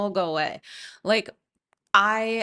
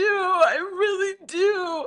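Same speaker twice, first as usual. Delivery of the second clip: stretched-out, high, tearful repeat; slowed mock crying Tom; 9 semitones higher, much slower than usual. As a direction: drag the plea out slowly and weepily. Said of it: "But he's like".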